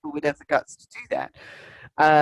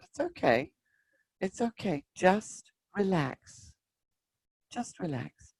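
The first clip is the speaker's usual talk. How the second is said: softer, slow and soothing; gently calm a frightened friend; soft reassuring voice; 8 dB quieter, slower than usual. Retold or imitated. A comforting inner voice answering her tantrum, tender. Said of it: "everything just softened".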